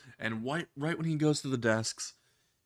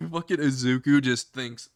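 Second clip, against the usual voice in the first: ordinary delivery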